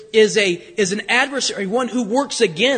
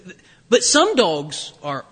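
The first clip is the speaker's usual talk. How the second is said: ordinary talk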